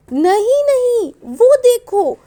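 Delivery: high, loud, drawn-out call of alarm; acted shout to warn the flock; pigeons' warning cry. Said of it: "कबूतर बैठे हैं वो क्या कह रहे हैं". (ऊँची आवाज़ में ख़बरदार करते हुए)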